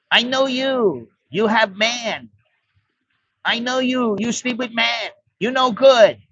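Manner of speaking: high-pitched broken English, accusing; drunk foreigner's pidgin English. Retold or imitated. Drunk foreigner accusing the bar girl, in high-pitched pidgin English. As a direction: play a drunk foreigner jabbing at her in pidgin. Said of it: "he starts in his pidgin Thai, pidgin English".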